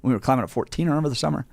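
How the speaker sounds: ordinary delivery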